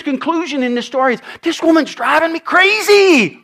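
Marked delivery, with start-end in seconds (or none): 1.4-3.4 exasperated judge. Acted.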